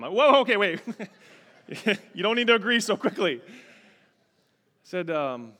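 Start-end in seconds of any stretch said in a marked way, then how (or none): 0.1-0.8 loud, high startled protest; comic defensive outburst; mock taken aback. Mock protest at daughter's quick agreement. 2.1-3.4 loud, high, quick comeback; playfully defensive, voice raised; hammed-up wounded objection; mock indignant protest. Joking protest to daughter.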